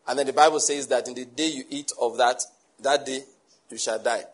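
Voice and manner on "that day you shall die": ordinary delivery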